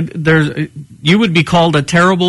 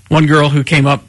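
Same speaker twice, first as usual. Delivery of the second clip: usual speaking voice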